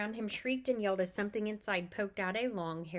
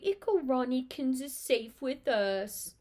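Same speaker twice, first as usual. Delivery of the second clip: put-on teasing baby talk; 4 semitones higher, 5 dB louder, slower than usual. A narrator's reading. Twin teasing Ron.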